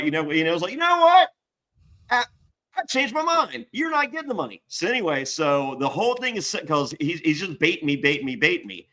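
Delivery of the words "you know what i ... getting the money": high, smug judge voice; hammed-up TV judge gotcha; mock judge. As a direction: play the judge springing a trap. Imitating judge reversing decision.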